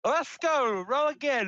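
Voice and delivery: loud, high cheer at the dice; hyped game-night cheer; excited cheer. Real, not acted. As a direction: cheer the roll on, pumped. Player cheering the dice roll.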